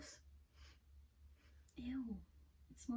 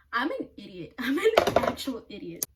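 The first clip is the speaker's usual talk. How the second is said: louder, laughing at herself; 9 dB louder than usual. Real, not acted. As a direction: laugh out the self-insult.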